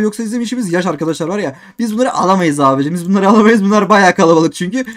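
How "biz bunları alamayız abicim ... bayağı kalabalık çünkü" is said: loud, worried cry about being overrun; real alarm, louder; alarmed gamer. Real, not acted. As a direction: sound swamped by enemies. Overwhelmed gamer under attack.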